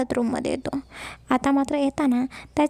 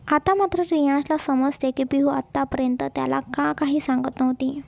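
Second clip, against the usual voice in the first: ordinary delivery, nothing marked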